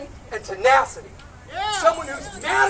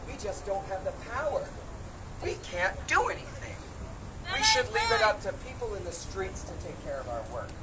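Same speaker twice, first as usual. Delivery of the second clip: mocking shrug; 4 semitones lower, 10 dB quieter than usual. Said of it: "Democrats in office today shrugging and saying".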